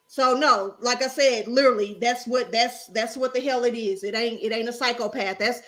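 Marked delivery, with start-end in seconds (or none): none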